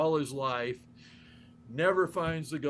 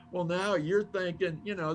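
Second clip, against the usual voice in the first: ordinary talk, nothing marked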